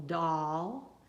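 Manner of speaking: "doll" drawn out as a lone syllable; sounded out. Shown as a demonstration. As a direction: sound out "doll" by itself, slowly. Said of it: "now sound out the syllable".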